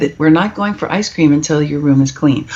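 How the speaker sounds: ordinary delivery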